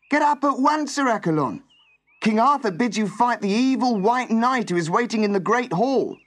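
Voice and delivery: high, loud urgent summons. A narrator's reading. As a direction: rouse a sleeper with an urgent call. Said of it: "cried the servant urgently".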